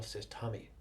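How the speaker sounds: ordinary talk, nothing marked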